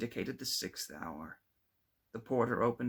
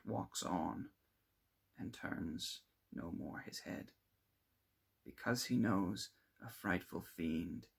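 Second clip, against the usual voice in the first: slow, hushed recitation of verse; poem recital; 3 semitones lower than usual. A narrator's reading. Narrator reciting a dread-filled poem, slow and hushed. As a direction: let each line settle, slow and grave.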